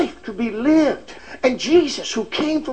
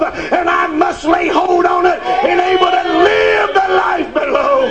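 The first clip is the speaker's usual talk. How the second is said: full-force preaching; 7 semitones higher than usual. Acted. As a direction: preach it out to the congregation.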